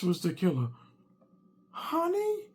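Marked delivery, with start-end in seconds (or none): 1.7-2.5 very high questioning Honey; wife calling. Retold or imitated. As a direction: sing the Honey way up. Wife asks the killer question.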